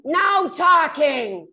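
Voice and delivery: slow, loud, drawn-out mock command; hammed-up shout of a stern old woman; bossy scold. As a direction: bark it like a snooty dance-floor busybody. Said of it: "and she went".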